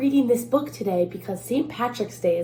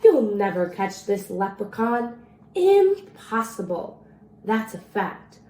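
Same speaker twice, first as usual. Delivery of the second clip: lively storybook read-aloud in the leprechaun's rhymes; reading to children. A narrator's reading. Librarian reading a leprechaun rhyme to children, playful read-aloud. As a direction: read it like a bedtime story for kids. Reads picture book to children.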